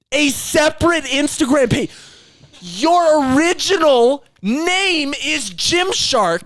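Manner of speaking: high-pitched, drawn-out exasperation; incredulous rant. Real, not acted. Host ranting to co-host about Gymshark's rebrand, incredulous.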